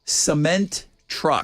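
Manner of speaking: each syllable slowly spelled out; slow and clear. Shown as a demonstration. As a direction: say it slowly, lip by lip. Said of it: "Read my lips".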